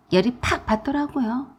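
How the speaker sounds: ordinary delivery, nothing marked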